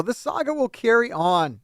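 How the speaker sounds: ordinary talk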